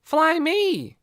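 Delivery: put-on high, loud little kid voice; small boy begging to be flown. A narrator's reading. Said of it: "said the other boy".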